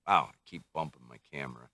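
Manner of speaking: usual speaking voice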